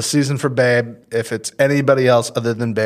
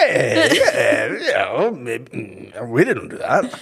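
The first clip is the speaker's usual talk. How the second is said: laughing fake innocence; slower than usual. Pretending innocence while laughing.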